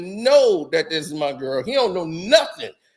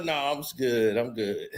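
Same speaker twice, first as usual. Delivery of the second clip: calm refusal; 9 semitones lower, 7 dB quieter than usual. Retold or imitated. Turning down the friend's offer.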